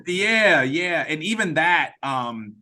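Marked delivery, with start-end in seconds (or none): none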